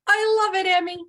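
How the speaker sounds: bright, loud delighted praise. Real, not acted. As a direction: gush over the answer. Teacher delighted by answer.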